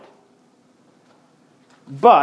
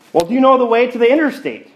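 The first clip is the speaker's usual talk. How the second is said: impatient; 7 semitones higher, 3 dB louder, much faster than usual. Retold or imitated. Impatient businessman voiced in story.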